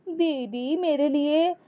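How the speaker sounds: slow, high, drawn-out plea; lightly acted wheedling call; calling after sister-in-law. A narrator's reading. Younger one calls after sister-in-law.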